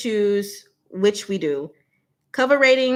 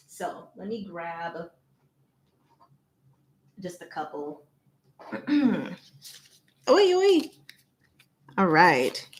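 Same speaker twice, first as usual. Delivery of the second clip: normal talking voice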